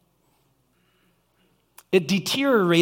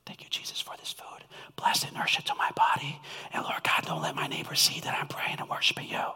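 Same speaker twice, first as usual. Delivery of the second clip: whispered prayer; 2 semitones lower, 10 dB quieter, faster than usual. Pretend. Mock timid prayer over food.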